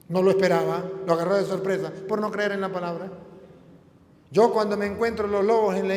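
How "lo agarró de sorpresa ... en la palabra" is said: ordinary delivery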